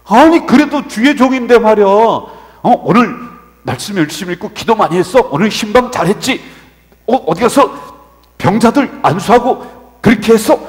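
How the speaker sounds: loud, mounting acted rant; inner indignation. Junior pastor imagining complaining to senior pastor, indignant. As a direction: fire off the complaints you dare not say aloud. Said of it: "속으로 그냥 막 운분이".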